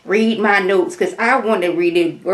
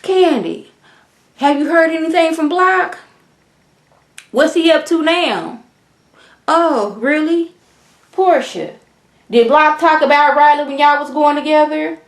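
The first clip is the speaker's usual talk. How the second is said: higher, sing-song innocent question; messy stirring; 8 semitones higher than usual. Retold or imitated. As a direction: play innocent while stirring drama. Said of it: "Sheree decides, "Let me be messy".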